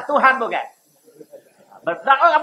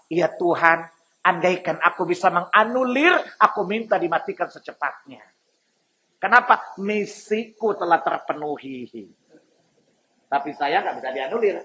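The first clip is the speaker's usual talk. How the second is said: Iblis voice; 5 semitones lower, 4 dB quieter than usual. Acted. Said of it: "Bahkan iblis bilang apa?".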